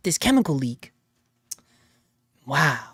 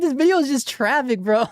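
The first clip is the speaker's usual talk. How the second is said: mock disappointed; 7 semitones higher than usual. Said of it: "I'm like".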